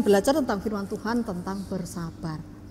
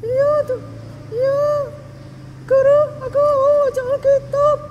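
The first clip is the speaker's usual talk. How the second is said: put-on high, loud childlike voice; a keen child volunteering to read; eager little girl; 9 dB louder than usual. Child voice again.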